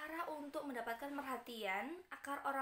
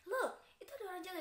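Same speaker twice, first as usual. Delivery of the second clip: higher, fast urgent Look; teacher acting an urgent call; excited alert; 6 semitones higher, faster than usual. Shown as a demonstration. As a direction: hurry to show someone fell. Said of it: "itu artinya kan lihatlah".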